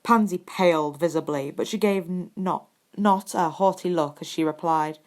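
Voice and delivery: ordinary delivery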